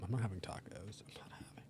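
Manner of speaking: unvoiced whispered refusal. Real, not acted. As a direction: whisper it aside, insistent. Whispering off-mic aside.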